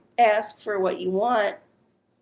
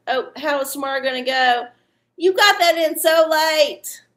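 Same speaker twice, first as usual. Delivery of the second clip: higher, louder scolding outburst; playful exasperated cry at a latecomer; mock complaint; 6 semitones higher, 5 dB louder than usual. Host to viewer with last-second question, mock complaint.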